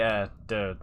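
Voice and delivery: usual speaking voice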